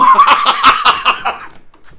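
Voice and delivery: loud high whooping laugh; giddy cackle. Acted. Scrooge alone in his room, loud giddy laugh. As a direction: burst into a splendid laugh. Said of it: "it was a splendid laugh".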